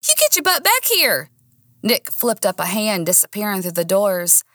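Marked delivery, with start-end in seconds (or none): 0.1-1.3 high-pitched Texas twang command. A narrator's reading. Woman hollering after Nick, best Texas twang. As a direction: order him back in a big Texas twang. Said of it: "unleashing my best Texas twang".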